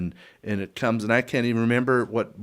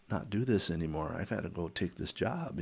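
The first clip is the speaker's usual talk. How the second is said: much softer, lower plea; subdued confession; 3 semitones lower, 10 dB quieter than usual. Retold or imitated. Husband telling his wife he must quit, hushed. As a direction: break it to her quietly.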